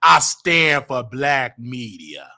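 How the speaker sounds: slow, deliberate declaration; slow and firm. Retold or imitated. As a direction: say it slowly, every word weighted. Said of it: "I said these words".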